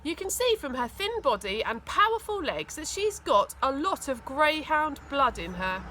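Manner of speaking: usual speaking voice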